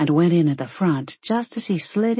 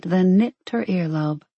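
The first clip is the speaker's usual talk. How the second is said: ordinary delivery, nothing marked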